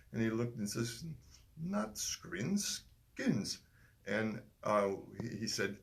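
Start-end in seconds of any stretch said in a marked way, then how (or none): 1.6-3.6 put-on high English accent correcting, "skins" stressed; a correcting Englishman, pitched high. Stranger correcting the lads' mistake, in a high English accent. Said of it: "I don't like to do the English accent".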